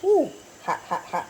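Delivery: talking through a hot bite; mouth full of hot food. Real, not acted. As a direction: burnt mouth, sucking air. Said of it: "it is hot".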